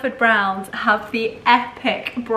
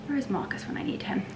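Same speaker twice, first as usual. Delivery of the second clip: fast whisper; 3 semitones higher, 8 dB quieter, much faster than usual. Real, not acted. Joking whispered aside.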